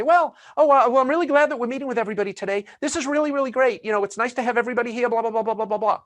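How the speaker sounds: high, rushed nervous speech; imitated nervous speaker. Nervous amateur speaker addressing a congregation or wedding, imitated high and fast. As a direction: race through it in a high voice, mimicking nerves. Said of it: "tone of their voice went up 'cause they were nervous".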